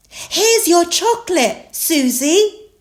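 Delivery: put-on high little girl; Peppa voice. A narrator's reading. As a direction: hand it over like a small child. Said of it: "Peppa said".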